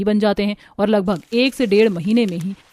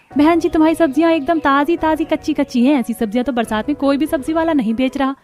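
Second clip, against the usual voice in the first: higher woman's voice, admiring; pleased customer; 6 semitones higher than usual. Acted. Customer praising the vegetable seller, admiring voice.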